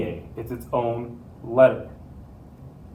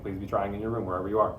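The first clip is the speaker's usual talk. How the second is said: normal talking voice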